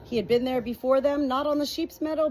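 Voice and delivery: plain reading voice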